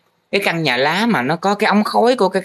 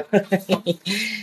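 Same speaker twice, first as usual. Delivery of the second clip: high laugh; 6 semitones higher, 6 dB quieter than usual. Real, not acted. Teacher catches scanned drawing.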